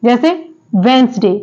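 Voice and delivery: normal talking voice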